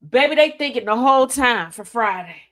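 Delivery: ordinary delivery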